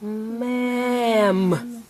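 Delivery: MA'AM with each sound held long; stretched out. Shown as a demonstration. Teacher prompting a child's rhyme, stretched out. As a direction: draw MA'AM out slowly. Sounding out, then blended word.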